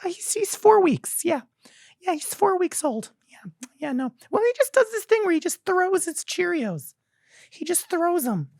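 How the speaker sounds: very high gushing mom voice; shrill-high cooing parent parody; annoying mother. Retold or imitated. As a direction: ham up a doting new mother bragging. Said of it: "annoying mothers who are just like".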